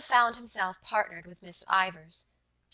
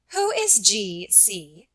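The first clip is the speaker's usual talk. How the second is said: slow, pointed question; much slower than usual. Acted. Miss Ivors questions him pointedly.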